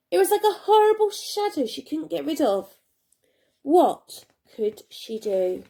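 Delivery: ordinary delivery, nothing marked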